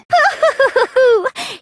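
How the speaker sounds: a short, gloating giggle that falls away; mocking laugh. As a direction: laugh at them, pleased with yourself.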